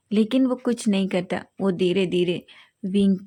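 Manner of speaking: usual speaking voice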